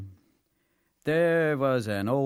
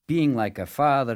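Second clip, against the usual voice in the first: plain reading voice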